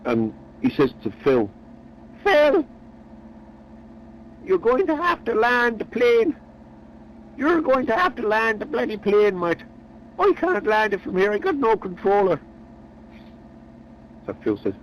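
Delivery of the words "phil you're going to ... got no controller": high, urgent pleading; panicked. Acted. Eric panicking, controller lost.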